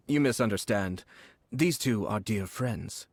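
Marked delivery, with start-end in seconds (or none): none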